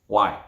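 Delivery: WHY said low, falling at the end; downward tone. Shown as a demonstration. Trainer asks a group, WHY in a downward tone. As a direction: drop the pitch on WHY. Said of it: "Downward tone".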